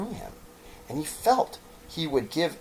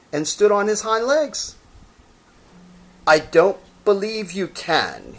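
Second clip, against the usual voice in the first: higher, taunting voice; doubting wild rabbit; 10 semitones higher, 6 dB louder than usual. Acted. Wild rabbit taunting the toy rabbit, higher. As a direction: challenge him.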